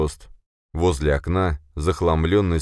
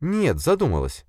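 much higher female-character voice; Sofia, defensive; 11 semitones higher than usual. Acted. Sofia denies flirting with boss, defensive. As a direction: brush it off in a high voice.

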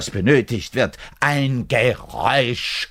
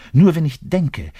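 higher and louder, bursting out; outburst; 7 semitones higher, 7 dB louder than usual. Acted. Bass player bursts out about thinking and lust. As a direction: blurt it out.

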